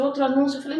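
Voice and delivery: usual speaking voice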